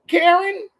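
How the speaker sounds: high, loud name-call in a comedian's voice; Katt Williams. Acted. Host mocking Karens, high Katt Williams impression. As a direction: call it out high-pitched and exasperated. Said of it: "it's my Cat Williams voice".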